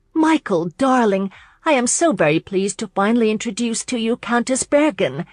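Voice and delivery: high, loud, gushing introduction; happy. A narrator's reading. Abby introduces the Countess to her husband, happily. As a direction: sing out happily, pitched well up. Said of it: "Abby happily replied".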